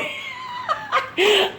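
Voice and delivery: high, amused giggle; real laugh. Real, not acted. Mom remembers teasing her kids, laughing. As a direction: giggle at your own story. Laughs at own retold exchange.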